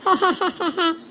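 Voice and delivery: quick cackling giggle; teasing laugh. Acted. Boys laugh at queasy friend, teasing cackle. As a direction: burst out laughing at your own joke.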